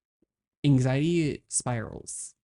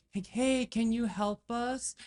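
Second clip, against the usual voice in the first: high sarcastic call; 10 semitones higher, 3 dB quieter than usual. Retold or imitated. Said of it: "Or like".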